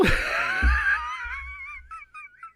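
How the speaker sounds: piercing high shriek of laughter. Retold or imitated. Remembering the awful Goblin line, a shrieking high laugh. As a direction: let out a wild screeching cackle. Retold goofy villain cackle.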